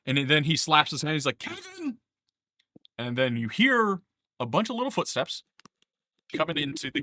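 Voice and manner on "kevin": very high, softer sing-out call. Acted. Game master as Grankor summoning his helpers, high-pitched call. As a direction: call the name out in a thin high voice.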